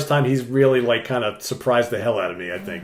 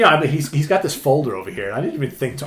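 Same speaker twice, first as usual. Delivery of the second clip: ordinary talk, nothing marked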